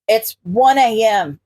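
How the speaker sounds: plain reading voice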